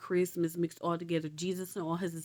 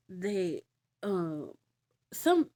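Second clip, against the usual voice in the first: ordinary talk, nothing marked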